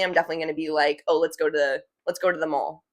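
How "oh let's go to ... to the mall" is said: to swallowed into tuh, casual and lower; fast casual tuh. Shown as a demonstration. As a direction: toss off to as a quick tuh. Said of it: "If I'm just speaking fast and casually".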